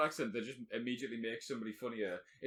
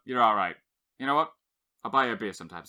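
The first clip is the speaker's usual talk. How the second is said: usual speaking voice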